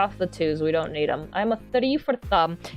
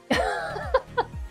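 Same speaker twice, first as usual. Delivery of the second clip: high, soft giggle; real giggle at oneself; laugh; 5 dB quieter than usual. Real, not acted. Streamer amused by their own accent attempt, high soft laugh.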